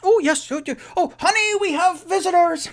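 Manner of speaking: very high, loud delighted halfling host; cheery halfling. Acted. Mister Peabody greeting returning guests at his door, very high and delighted. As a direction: squeal a bright welcome up high. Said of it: "and he goes".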